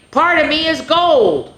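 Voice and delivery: high, proud announcement; excited. A narrator's reading. Pointy boasting to his friends, high and thrilled. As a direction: show it off with glee.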